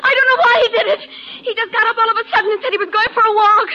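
upset, voice near tears; tearful. Acted. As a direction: fake grief, close to crying. Wife acting grief over husband.

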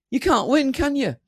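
high-pitched, incredulous complaint; mock exasperation. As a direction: throw it up high, as if giving up on them. Voicing Jesus' exasperated retort.

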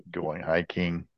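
usual speaking voice

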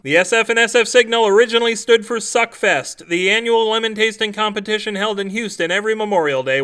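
loud, brisk mock ad read; comic outro read. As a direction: rattle off the plugs like a cheesy radio ad.

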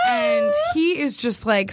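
ordinary talk, nothing marked

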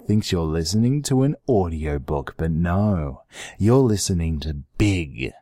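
low, slow sultry murmur; sexy voice. Acted. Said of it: "I'm doing, like, a sexy thing".

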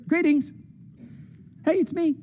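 high, light and breezy hello; jaunty high greeting; playful greeting. Pretend. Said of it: "humorous return of the king".

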